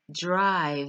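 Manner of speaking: "drive" drawn out slowly, final v buzzing very long; exaggerated V. Shown as a demonstration. Teacher telling learners to exaggerate, long buzzing final v. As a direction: hold the v of "drive" as long as possible. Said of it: "you must exaggerate that sound".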